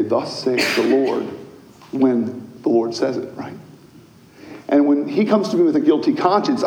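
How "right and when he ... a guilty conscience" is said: usual speaking voice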